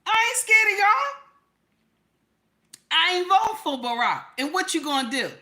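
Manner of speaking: very high, loud mock defiance; high defiant squeal. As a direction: squeal back defiantly at the crowd. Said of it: "I said".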